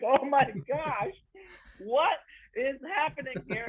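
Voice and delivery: high panicked whisper-like gasp; shocked. Retold or imitated. Son horrified at dad's comment, high and panicked. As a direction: recoil in horrified disbelief.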